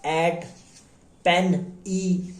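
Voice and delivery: each example word spaced out slowly. Shown as a demonstration. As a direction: lay out every word one at a time. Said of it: "ये कौन से, किससे?". (हर शब्द धीरे-धीरे, अलग-अलग बोलें)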